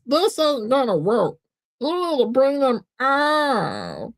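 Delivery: dejected high cartoon voice; high and drawn out, sinking in defeat; Meatwad. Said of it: "and he dejectedly, he says".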